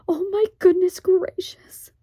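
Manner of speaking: high, loud fretful moan; moaning Homily. Acted. Homily despairs at Pod's plan, high and moaning. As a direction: wail it in dismay. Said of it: "moaned Homily".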